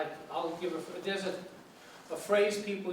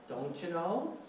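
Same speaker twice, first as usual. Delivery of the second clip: lilting Minnesota accent, rising at the end; 4 dB quieter, slower than usual. Shown as a demonstration. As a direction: imitate a sing-song Minnesotan. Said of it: "talk like someone from Minnesota".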